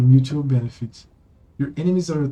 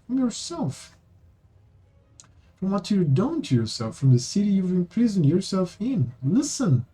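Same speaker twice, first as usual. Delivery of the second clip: put-on higher-pitched earnest young companion pleading; Amber, earnest; 6 semitones higher than usual. Amber urging the queen to save herself, higher and earnest. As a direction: coax her gently, voice lifted.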